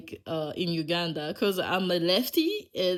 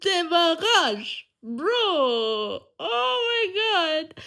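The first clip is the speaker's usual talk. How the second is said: high, loud, drawn-out wail; mock anguish; 11 semitones higher, 7 dB louder, much slower than usual. Driver dreading the démarrage test, mock anguish. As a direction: wail each word slowly in hammed-up despair.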